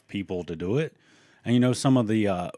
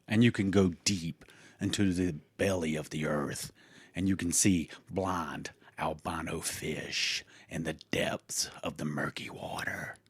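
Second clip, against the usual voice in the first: spooky hush; 4 semitones lower, 6 dB quieter than usual. Acted. Spooky cave description.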